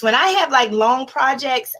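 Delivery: usual speaking voice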